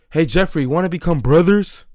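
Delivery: loud, cocky retold question; acted-out loud heckle; mock brash taunt. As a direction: imitate a drunk friend calling out obnoxiously. Retold drunk friend's brash taunt.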